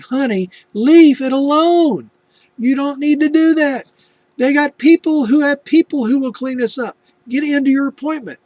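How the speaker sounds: high, loud, slowed pleading; urging wife. Retold or imitated. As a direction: coax her away from the mess. Husband urging wife inside.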